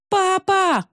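very high loud wail of Папа!; wailing call. Acted. Русалка вопит вслед уплывающему отцу, очень высоко и громко. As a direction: howl Папа! high after her father. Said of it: "русалка завопила".

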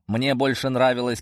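plain reading voice

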